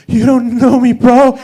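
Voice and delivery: high, loud cracking tough-guy line; squeaky adrenaline voice. Retold or imitated. Driver threatening a neighbour, voice squeaking high and loud. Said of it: "it came out like".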